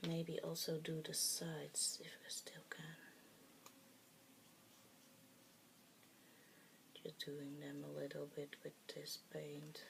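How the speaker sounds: soft, low-key mumble while busy painting; real mumbling. Real, not acted. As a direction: mumble quietly to yourself, absorbed in the task. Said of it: "Sorry, I'm mumbling".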